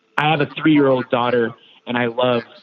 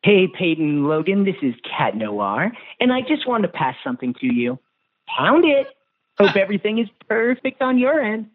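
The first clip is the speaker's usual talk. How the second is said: put-on playful superhero cat voice, hero greeting to kids; Cat Noir voice; 7 semitones higher than usual. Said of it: "in your Cat Noir voice".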